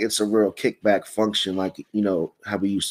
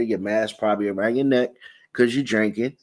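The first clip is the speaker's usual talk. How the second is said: ordinary delivery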